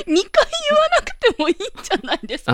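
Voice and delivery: giggling through the words; can't stop laughing; laughing fit. Real, not acted. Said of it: "ツボでハマって、ちょっと今、半泣きになってきました".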